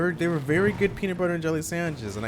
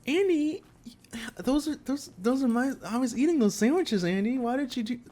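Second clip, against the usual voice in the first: high, strained disbelief, halting; acted speechless hurt; stunned dismay; 6 semitones higher than usual. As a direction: plead in hushed, stumbling shock. Acting stunned first reaction to Andy.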